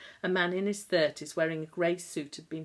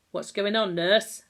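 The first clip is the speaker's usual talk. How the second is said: loud, high demand across the ward; booming voice; 4 semitones higher, 9 dB louder than usual. A narrator's reading. Ward sister booming at the nurse from the end of the ward, loud demand. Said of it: "boomed a loud voice".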